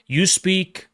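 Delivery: normal talking voice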